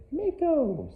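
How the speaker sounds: dog's name in a high squeal; high-pitched call. Shown as a demonstration. Trainer testing the dog's stay, high-pitched call.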